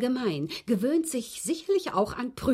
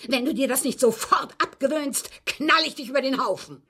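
loud, higher threatening outburst; John's threat; 5 semitones higher, 5 dB louder than usual. Retold or imitated. Maid quoting John threatening her, louder and higher. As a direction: imitate John threatening to shoot. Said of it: "sagte er".

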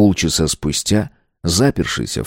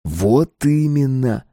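slow, raised, knowing confirmation; meaningful; 5 semitones higher, slower than usual. A narrator's reading. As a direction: stretch "Вот именно" out with significance. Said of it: "сказал Стас многозначительно".

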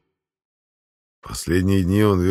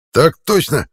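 loud high strained answer; squeezed out reply; 9 semitones higher, 6 dB louder, slower than usual. A narrator's reading. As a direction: force it out winded but loud. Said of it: "ухитрился выдавить я".

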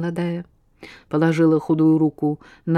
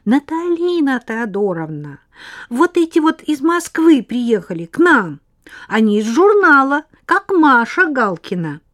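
loud chatter; 8 semitones higher, 6 dB louder than usual. Acted. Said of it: "Не кричи".

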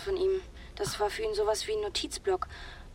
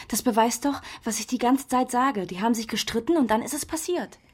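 excited insistence; 2 semitones higher, 4 dB louder than usual. Acted. Excited realisation.

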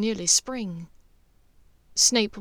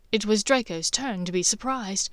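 ordinary delivery, nothing marked

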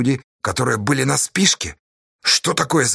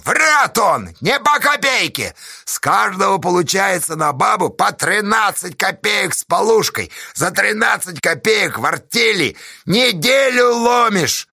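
gleeful yelling; 8 semitones higher than usual. A narrator's reading. Pozdey proving Astasha lied, triumphant shouting. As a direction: crow the accusation to the crowd. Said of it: "радостно заорал Поздей".

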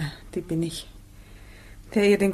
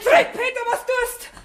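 alarmed cry. Acted. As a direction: cry out the names in fright. Calls out in alarm.

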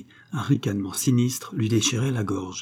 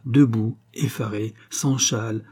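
usual speaking voice

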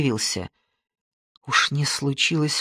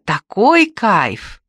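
slow, drawn-out, higher and louder gasp of delight; amazed delight; 5 semitones higher, 6 dB louder, much slower than usual. Pretend. Imagined mother amazed at daughter's dinner, drawn-out delight. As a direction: savour every word, stunned with joy. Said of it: "Вот мама удивится".

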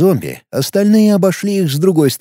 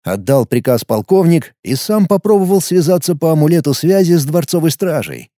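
usual speaking voice

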